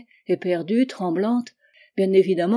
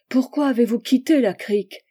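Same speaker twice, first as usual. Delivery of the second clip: higher, louder anguished question on "quitté"; Charlotte's pleading "Pourquoi avez-vous quitté la crique?" higher and louder; 4 semitones higher, 5 dB louder than usual. A narrator's reading. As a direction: she presses him, voice climbing on "quitté". Charlotte demands an answer.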